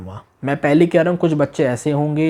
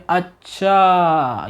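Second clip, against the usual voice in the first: "अच्छा" stretched very slowly; drawn-out understanding; 2 semitones higher, much slower than usual. Shown as a demonstration. Teacher shows a slow "अच्छा" when something is explained. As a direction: draw out "अच्छा" long as it sinks in. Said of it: "कोई समझा रहा है".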